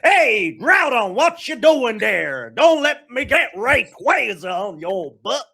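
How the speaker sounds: loud, high, slow hammed-up Texan drawl; bad Texas accent. Host voicing Kyogre calling to Groudon in a bad Texas accent. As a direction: holler it like a fake cowboy Kyogre. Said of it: "which is totally not a Texas accent".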